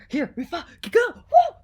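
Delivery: loud, high burst of real excitement; hyped-up yell rising to a whoop; excited whoop. Real, not acted. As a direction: kick off loudly and finish on a high "woo". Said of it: "I'm so excited".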